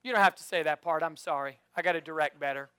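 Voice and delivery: usual speaking voice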